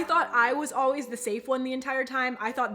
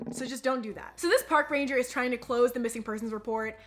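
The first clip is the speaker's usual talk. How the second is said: ordinary talk, nothing marked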